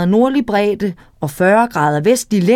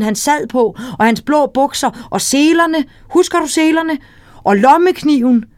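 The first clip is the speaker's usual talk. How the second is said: ordinary delivery, nothing marked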